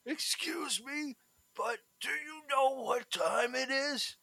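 put-on high, slow, quavering old man; a polite old man asking the time, high and slow; kind old man. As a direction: creak it out gently like a frail grandpa. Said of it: "this kind old man".

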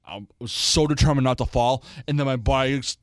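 usual speaking voice

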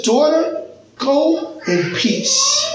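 drawn-out, tender words of peace; tender blessing. Acted. Jesus speaking to healed woman.

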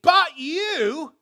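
high, loud cry of "But you"; shouted emphasis. Acted. Preacher crying out to the church with force. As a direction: hurl it at the congregation. Preacher bursts out in scripture reading.

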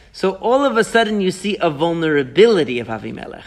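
usual speaking voice

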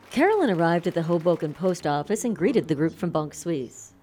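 usual speaking voice

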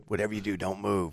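low, soft hunter's instruction; hushed warning. Retold or imitated. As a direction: quietly caution a novice to stay put. Hunting instruction to a novice, retold.

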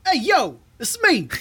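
high put-on comic voice; Bernie Mac impression.

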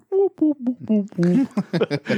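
high falling whoops mimicking a video game dying; imitated Pac-Man death. Joking about Pac-Man dying, falling whoops. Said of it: "Very good, [name]".

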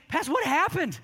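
high-pitched retold amazement; acted-out incredulous question; astonished question. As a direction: ask it high, as if stunned by the news. Amazed missionary questions the pastor.

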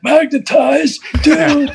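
high-pitched surfer-bro impression; surfer dude. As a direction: draw it out like a stoked surfer. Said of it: "sounds like a surfer dude".

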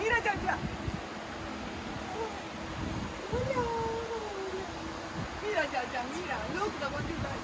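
high, soft coaxing voice. Real, not acted. Coaxing an alpaca, high and soft. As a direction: gently coax the animal.

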